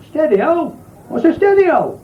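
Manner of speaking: high, baffled woman's voice; a naive woman asking about the stereo; puzzled woman. Acted. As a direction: squeak the question in disbelief.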